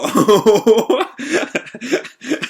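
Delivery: real high, loud laugh; helpless, high-pitched laughter; bursting laugh. Real, not acted. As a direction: burst out laughing mid-sentence. Laughs while reading the recap.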